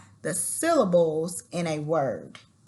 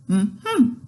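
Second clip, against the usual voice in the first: two loud hummed syllables, rising; pushed closed-mouth "mm-hmm", word-shaped; lips-sealed hum; 3 semitones higher, 6 dB louder than usual. Shown as a demonstration. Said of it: "gonna try to well talk".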